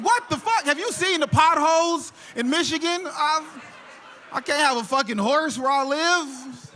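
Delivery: high-pitched incredulous rant; exasperated disbelief. Acted. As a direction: protest the absurd advice. Exasperated reply to dad's advice.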